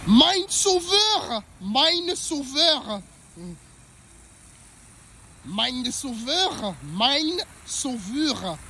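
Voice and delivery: a small high sing-song cry dragged out slowly; little voice. Pretend. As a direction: stretch it out in a tiny high voice, letting it die away. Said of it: "vous avez aimé ma petite voix".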